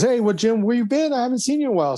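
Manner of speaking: high, bright greeting voice; imitating a cheerful coworker; friendly coworker. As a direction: coworker surprised to see him back. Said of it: "He goes".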